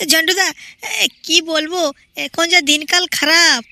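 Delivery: ordinary talk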